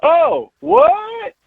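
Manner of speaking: high-pitched, startled co-host impression; mock show-prep reaction. Co-host mocking a colleague's show prep, high mock surprise. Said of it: "B-Sox and his show prep".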